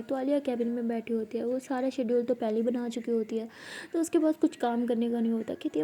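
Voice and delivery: plain reading voice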